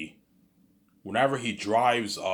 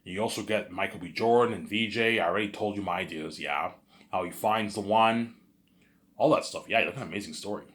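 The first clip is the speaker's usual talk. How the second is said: ordinary delivery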